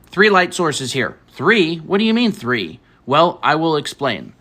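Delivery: clear, slow explainer; lesson voice. Acted. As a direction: explain it like a teacher. Teaching art stream style.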